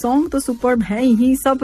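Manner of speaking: ordinary talk, nothing marked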